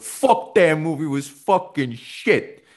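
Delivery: high, drawn-out imitation of blunt outrage; mock outraged outburst. Podcaster imitating two Sopranos actors on House of Gucci, mock outburst. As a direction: burst out as the blunt actor, hammed up. Acting out the other two cast members.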